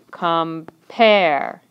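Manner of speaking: "Compare" as com-PARE, split and weighted on pare; stress on second syllable. Shown as a demonstration. Teacher taps the iamb, "Compare" with stress on PARE. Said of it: "My foot's gonna go back up now".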